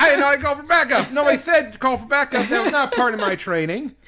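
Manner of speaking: high, loud laughing disbelief; laughing loudly. Real, not acted. Laughing to cohost about missing training. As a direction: crack up while protesting loudly.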